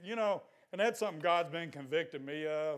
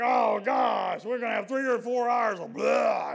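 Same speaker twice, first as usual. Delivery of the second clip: exasperated groan; 6 semitones higher, 9 dB louder than usual. Retold or imitated. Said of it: "then it's like".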